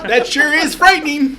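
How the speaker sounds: put-on high laughing old-timey kid voice; gee-whiz boy, wide-eyed and giddy; wholesome 1950s kid. Continuing the gee-whiz kid bit.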